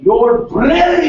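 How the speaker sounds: South African accent, shouted high. Shown as a demonstration. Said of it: "just say it with a South African accent".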